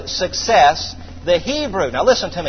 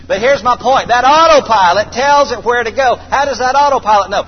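higher, louder preaching push; full-force preaching; 5 semitones higher, 6 dB louder than usual. Acted. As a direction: drive the point home to the congregation.

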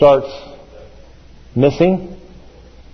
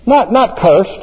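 ordinary talk, nothing marked

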